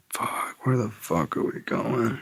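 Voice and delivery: slow, soft worried mutter; hushed nervous question; anxious muttering. Retold or imitated. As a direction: murmur it to yourself, uneasy. Lost in a car, worried.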